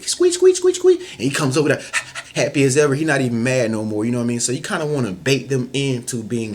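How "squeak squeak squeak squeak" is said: high squeaky toy squeaks; high toy squeak imitation; squeaky toy noise. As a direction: squeak it like a dog toy, much higher. Squeezing a squeaky dog toy.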